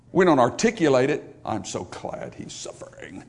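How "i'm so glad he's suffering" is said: whispered, slow and gloating; mock gloating whisper; sly whisper. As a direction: whisper it like a guilty secret. Voicing a hidden thought.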